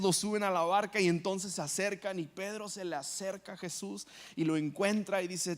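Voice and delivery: normal talking voice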